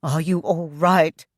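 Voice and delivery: tight, strained question. Acted. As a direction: force it out, strained. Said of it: "in a strained voice".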